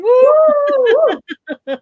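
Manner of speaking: high, loud "woo"; whooping cheer. Real, not acted. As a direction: let out a long celebratory whoop. Cheering a shout-out to viewer.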